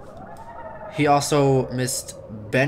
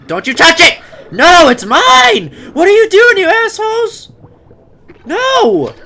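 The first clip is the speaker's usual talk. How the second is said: high, loud possessive yelling; angry shouting; 13 dB louder than usual. Pretend. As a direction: yell at the thieves to back off. Creatures eating his tree.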